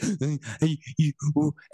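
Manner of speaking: imitated gasping, weeping breaths; hyperventilating sobs. Child crying at dad, imitated hyperventilating. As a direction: mimic a child's gulping, hitching sobs. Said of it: "that hyperventilating, like".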